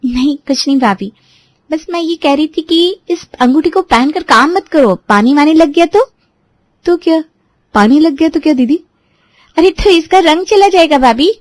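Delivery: put-on sweet impatient young girl teasing her bhabhi; a light, high young girl's voice; young sister-in-law. A narrator's reading. Pallavi covering herself.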